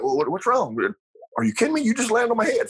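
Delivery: bewildered dad. Retold or imitated. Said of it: "he was just so bewildered".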